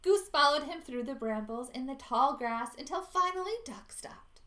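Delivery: usual speaking voice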